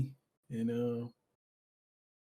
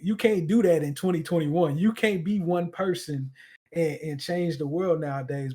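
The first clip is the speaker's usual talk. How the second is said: louder, higher, emphatic naysayer; raised-voice imitation of a doubter; mock doubter; 4 semitones higher, 8 dB louder than usual. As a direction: voice an incredulous sceptic, pushing each point hard. Voices doubters of changing the world.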